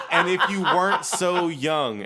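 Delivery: laughing, high and coy; flirty laughing. Retold or imitated. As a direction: tease him through laughter. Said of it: "she's like".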